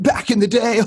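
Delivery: high, silly mock drunk buddy; drunk college buddy. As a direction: squeak it out like a goofy college buddy. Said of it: "your drunk buddy from college says it".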